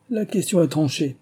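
mildly solemn verdict. A narrator's reading. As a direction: declare the matter settled with gravity. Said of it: "prononça Brown avec solennité".

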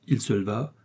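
ordinary delivery, nothing marked

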